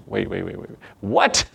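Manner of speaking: mock confusion, muttered waits then What; baffled listener. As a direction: play someone thrown by what they heard.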